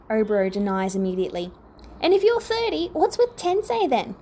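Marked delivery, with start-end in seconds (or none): 2.0-4.1 high, puzzled question; baffled. A narrator's reading. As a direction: ask in confused disbelief. Confused question at a friend.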